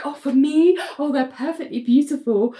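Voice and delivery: high put-on sleep-talking voice; Bria, dreamily delighted. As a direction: imitate a dreamer receiving flowers. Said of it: "having this conversation in her dream".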